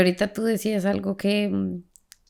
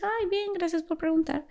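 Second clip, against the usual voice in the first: voicing Chais; 10 semitones higher, 6 dB quieter than usual. Chais voiced in story.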